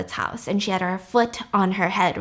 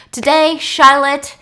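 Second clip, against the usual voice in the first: loud, slow showman's opening, higher; grand announcement; 5 semitones higher, 10 dB louder, slower than usual. Retold or imitated. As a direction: announce her to the whole room. Villain announces to seated audience.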